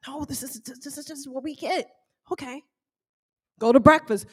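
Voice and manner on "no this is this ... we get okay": hushed reply. Retold or imitated. Husband explaining the cabin gifts to wife, hushed. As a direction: answer quietly, playing it down.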